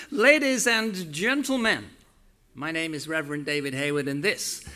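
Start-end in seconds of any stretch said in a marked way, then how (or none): 0.1-1.8 slow, loud showman welcome; announcer welcome. Real, not acted. Host opening church, booming welcome to the crowd. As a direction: greet the congregation like a ringmaster.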